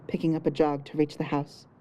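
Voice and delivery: ordinary delivery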